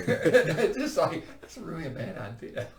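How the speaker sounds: talking through laughter; laughing. Real, not acted. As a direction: laugh while saying it. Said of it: "Did you say bad or not a bad idea?".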